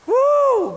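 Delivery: shouted woo of praise; joyful whoop. Real, not acted. Preacher exclaims in joy.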